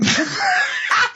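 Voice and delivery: high burst of laughter. Real, not acted. Laughing at tall friend.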